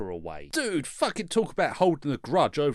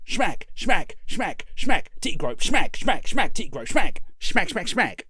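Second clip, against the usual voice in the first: rhythmic smacks; 5 dB louder than usual. Acted. Rhythmic fight narration.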